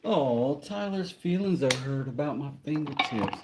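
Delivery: high, babying mock sympathy; mock pity. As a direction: coo at a wounded complainer, sarcastically. Teasing a viewer who complained.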